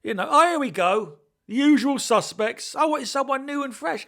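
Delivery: high, loud indignant outburst; outraged disbelief. Retold or imitated. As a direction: react like the casting is a joke. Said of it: "I was like".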